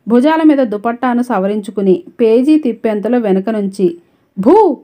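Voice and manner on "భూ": sudden loud, high "boo"; startling shout. A narrator's reading. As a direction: jump out with a raised-voice boo. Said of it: "అని గట్టిగా అరిచారెవరో". (వెనక నుంచి భయపెట్టే అరుపు)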